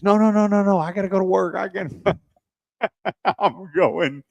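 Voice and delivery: high-pitched imitation of a hasty objection; mock frantic protest. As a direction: imitate someone pleading their way out of trouble. Retold defendant protesting to judge.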